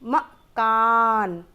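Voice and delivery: मकान said slowly and firmly, falling; slow emphatic answer. Shown as a demonstration. Teacher confirming the right word to children, slow and firm. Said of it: "वो क्या था?".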